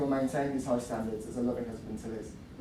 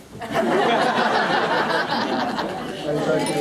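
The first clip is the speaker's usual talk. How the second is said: loud laughter; 8 semitones higher, 8 dB louder than usual. Real, not acted. Crowd laugh after bar staff.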